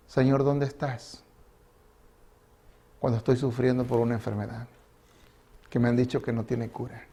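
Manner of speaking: a hushed, aching cry of "where are you"; pleading lament. Acted. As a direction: plead quietly from deep pain. Anguished prayer of the sufferer.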